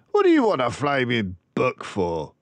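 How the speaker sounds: a lightly voiced scoffing dad; scornful father. A narrator's reading. Father scoffing at his daughter's request, lightly voiced.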